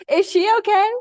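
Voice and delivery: high, laughing mock-concern; mock stranger. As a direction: imitate a baffled viewer. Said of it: "people who don't know me are gonna be like".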